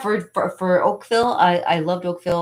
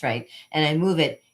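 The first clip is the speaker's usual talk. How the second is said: ordinary delivery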